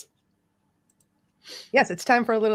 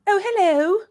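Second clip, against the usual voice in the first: high-pitched, hammed-up English hello; badly faked English accent; 12 semitones higher, 4 dB louder than usual. Shown as a demonstration. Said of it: "Oh, I'm doing English".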